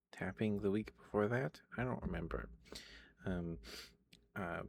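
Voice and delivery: usual speaking voice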